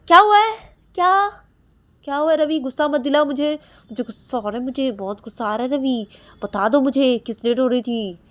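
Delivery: very high, very loud furious yelling; furious shout. Acted. Husband shouting at wife that he is very angry. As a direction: explode with anger.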